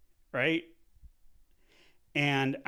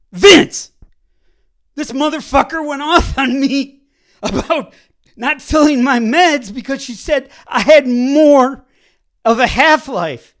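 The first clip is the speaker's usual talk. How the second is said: loud, higher, laughing rant; outraged rant; 6 semitones higher, 15 dB louder than usual. Retold or imitated. Calling his friend Vince to vent, loud outraged rant. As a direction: vent it all at once.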